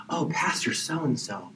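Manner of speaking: breathless whisper of adoration; awed whisper. Retold or imitated. A congregant whispering in awe of the pastor. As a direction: whisper the name like it's holy.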